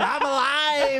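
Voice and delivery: high-pitched imitation of a jubilant cry; mock exuberance. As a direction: mimic your mate proclaiming it, pitched high. Mimics housemate's exuberant cry.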